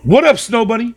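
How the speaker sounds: high, loud hey-there call; light up to greet a regular; excited greeting. Real, not acted. Streamer greets viewer Snow Bunny, high and loud.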